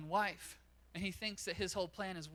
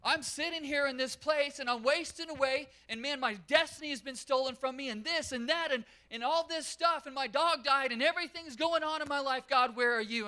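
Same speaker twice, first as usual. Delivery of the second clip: much higher, much louder self-pitying wail; mock believer wailing at God about a stolen destiny; whiny complaint to God; 8 semitones higher, 10 dB louder than usual. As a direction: moan at God like everything's unfair. Whining complaint voiced to God.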